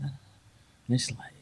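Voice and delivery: normal talking voice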